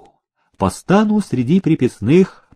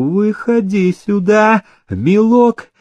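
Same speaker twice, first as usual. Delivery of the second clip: high, drawn-out honeyed call; syrupy overseer beckoning; sugary summons; 9 semitones higher, slower than usual. A narrator's reading. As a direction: coax him forward sweetly. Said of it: "слащавым голосом окрикнул он Ивашку".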